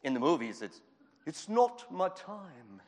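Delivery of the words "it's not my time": movie Jesus. Pretend. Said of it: "They didn't speak like that either".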